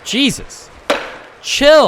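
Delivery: high, loud exasperated shout; loud exclamation. Real, not acted. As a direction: blurt it out, exasperated. Yelling at the chamber.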